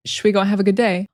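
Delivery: "Sweet" as "shwi", run into "go"; casual blended "shwi" opening for "Sweet"; reduced "should we". Shown as a demonstration. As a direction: glide from "shwi" into the phrase. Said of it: "we just say the sh".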